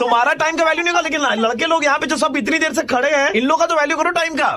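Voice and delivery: fast, very high, loud mock tirade; put-on ranting. Prank caller berating her for keeping boys waiting, shouted rant. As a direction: shout with exaggerated indignation.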